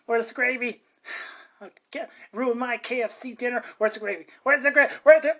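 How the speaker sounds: grumpy boss. Acted. Grumpy boss hunting gravy.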